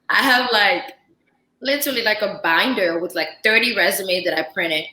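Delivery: usual speaking voice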